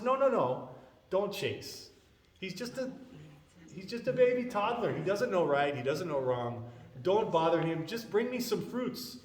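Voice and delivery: high-pitched, urgent appeasing; pleading intercession. Retold or imitated. Brahmana stops father chasing the toddler, high-pitched pleading. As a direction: plead for the child, pitch lifted.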